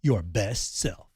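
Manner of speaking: upbeat sponsor read. Acted. Husband reading a sponsor ad to listeners. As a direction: sell it brightly.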